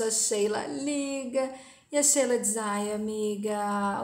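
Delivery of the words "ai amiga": drawn-out, slow gushing commiseration; fake sympathy. A narrator's reading. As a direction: stretch it out with mock pity. Character gushes false sympathy to friend.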